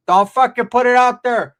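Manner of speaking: slow, loud, high mock warning; angry aunt imitated. Aunt warns him, acted out.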